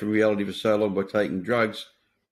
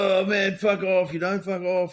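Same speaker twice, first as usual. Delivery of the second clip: groggy mates; 9 semitones higher, slower than usual. Retold or imitated. Hungover mates answer the phone.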